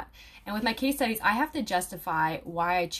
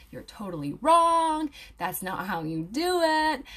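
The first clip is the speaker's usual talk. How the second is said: slow, drawn-out mock telling-off; mock scolding commenter; 6 semitones higher, 5 dB louder, slower than usual. Commenters correct an aromatherapy student, mock scolding. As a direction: imitate a know-it-all correcting someone, stretching the words. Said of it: "come onto my post and be like".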